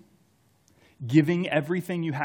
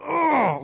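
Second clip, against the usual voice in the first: a sharp, much higher groan of frustration; frustrated groan; 14 semitones higher, 6 dB louder than usual. Retold or imitated. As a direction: let out a strangled cry of exasperation. Venting frustration at David.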